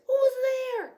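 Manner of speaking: high, loud startled cry; frightened cry. Acted. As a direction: shriek it like a scared mouse. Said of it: "Mouse cries".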